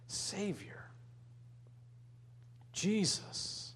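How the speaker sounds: breathy hushed whisper of the name. Acted. Preacher whispering the name Savior to the congregation. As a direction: whisper it like a secret prayer.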